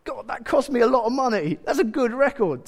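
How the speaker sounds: high-pitched mock dismay; pained protest. Young believer protesting to God, mock dismayed. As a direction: plead for the record, pitch up.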